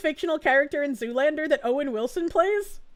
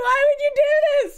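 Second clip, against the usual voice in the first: mock betrayed wail; 10 semitones higher, 4 dB louder than usual. Retelling the friend's prank as a mock betrayal. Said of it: "It's like".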